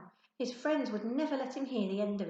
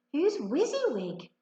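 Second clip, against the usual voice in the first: put-on girl's voice; 7 semitones higher, 5 dB louder than usual. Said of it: "she asked them".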